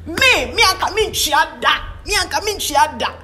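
mocking the women. Women's mock sympathetic reaction.

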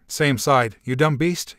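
usual speaking voice

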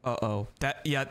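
normal talking voice

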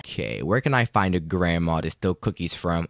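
ordinary talk, nothing marked

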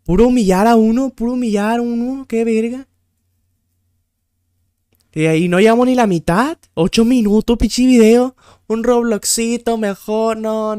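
high, exasperated complaining; fed-up rant. Real, not acted. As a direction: protest loudly at being humiliated. Fed up, rants about humiliating quiz. (queja harta, aguda y exaltada)

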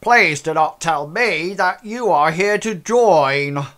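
loud, high, slow weary mob boss; gangster boss voice. Acted. Cigar-smoking boss addressing newcomers, loud weary drawl. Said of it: "and he says".

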